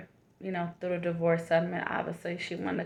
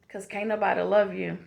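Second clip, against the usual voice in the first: motivational affirmation. Acted. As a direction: speak each line like a loving reminder. Affirmation talk to the viewers.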